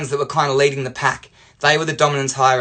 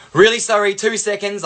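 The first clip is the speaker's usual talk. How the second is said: higher, apologetic hail; calling over; 6 semitones higher than usual. Retold or imitated. Dad calling English teacher from the window, urgent. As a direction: re-enact flagging down a passer-by.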